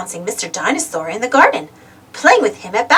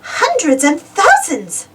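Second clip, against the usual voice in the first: excited cry; 8 semitones higher, slower than usual. A narrator's reading. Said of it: "cries Peppa".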